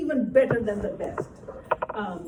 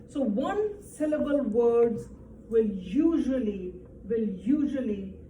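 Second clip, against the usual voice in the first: slow teaching emphasis. Shown as a demonstration. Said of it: "teacher spells out the rule".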